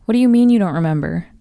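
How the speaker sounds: plain reading voice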